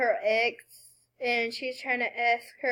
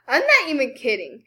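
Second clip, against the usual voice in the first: ordinary delivery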